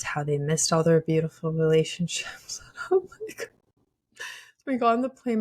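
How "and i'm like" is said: high laughing break. Real, not acted. Laughing while telling her friend about the plane. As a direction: laugh through the words, high up.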